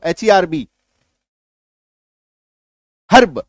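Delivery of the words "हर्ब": हर्ब with the R clearly sounded, "harb"; full R pronunciation. Shown as a demonstration. Teacher showing the common mistake, हर्ब with hard R. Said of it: "हम लोग क्या बोलते हैं".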